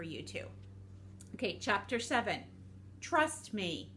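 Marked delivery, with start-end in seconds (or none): none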